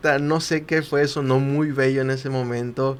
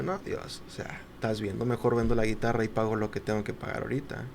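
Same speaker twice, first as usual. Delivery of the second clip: hushed inner doubt; 3 semitones lower, 8 dB quieter than usual. Retold or imitated. Imagined hesitant inner reply.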